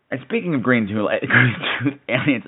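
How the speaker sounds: ordinary talk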